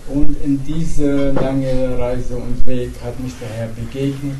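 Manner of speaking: ordinary talk, nothing marked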